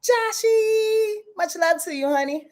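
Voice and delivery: high, fond sign-off to a friend; shower him with love; affectionate. Real, not acted. Host sending love to viewer Josh, high and fond.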